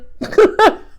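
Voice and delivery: breathy high giggle after blurting; sheepish high giggle; embarrassed laugh. Real, not acted. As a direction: laugh it off, caught out.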